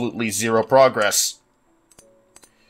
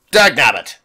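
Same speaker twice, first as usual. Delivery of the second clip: louder snapped mock cartoon cry of dag nabbit; quick hammed-up old prospector curse; cartoon old-timer; 5 dB louder than usual. Gamer repeating his curse, in a short cartoon old-timer voice.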